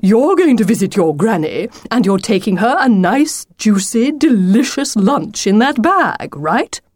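lightly voiced hungry wolf; eager wolf, relishing. Wolf guessing what Lucy carries for granny, relishing.

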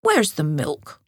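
a hint of a demanding squirrel; lightly voiced, a little impatient; lightly voiced proud squirrel. A narrator's reading. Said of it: "said Squirrel".

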